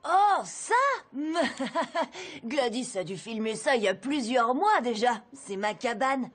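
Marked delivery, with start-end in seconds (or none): 0.8-2.4 awkward, higher laugh to cover; nervous laugh. Acted. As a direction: laugh it off, caught out. Boy caught, laughs it off. (petit rire gêné)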